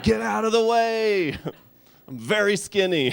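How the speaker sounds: high, loud, slow yell with a laugh; mock old-man shout. Comic barging into a crowded Chipotle, mock old-man shout. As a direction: bark it like the cranky old man.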